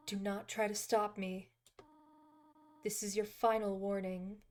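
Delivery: soft, measured character reading; motherly game character. Acted. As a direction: read it gently, like a grave guardian. Reads game character's dialogue aloud.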